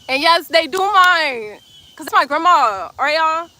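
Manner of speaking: high, loud, slow insistence; protective insistence. Real, not acted. Streamer defending her grandma in the comments, loudly. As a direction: stand up for grandma firmly.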